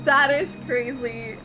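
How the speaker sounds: ordinary delivery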